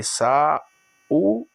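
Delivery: "saúde" broken into sa, ú, de; long gaps between the syllables of "saúde". Shown as a demonstration. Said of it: "Porque a sílaba dessa aqui".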